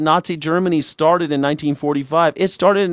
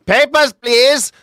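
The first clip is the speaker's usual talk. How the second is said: loud, high, slow guard bark; cool foreign spy menace; 11 semitones higher, 6 dB louder, much slower than usual. Pretend. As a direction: shout it like a checkpoint guard. Mock guard shouting.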